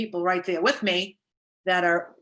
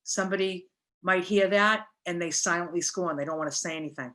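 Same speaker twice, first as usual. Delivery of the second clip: ordinary talk, nothing marked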